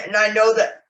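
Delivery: choked up, catching on a sob; real tearful emotion. Real, not acted. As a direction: apologise through rising tears. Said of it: "excuse me for getting emotional".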